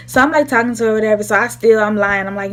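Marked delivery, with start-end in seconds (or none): none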